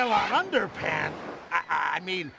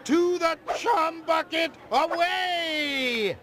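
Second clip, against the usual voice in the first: heroic shout; 7 semitones higher, slower than usual. Acted. Heroic rallying cry to go.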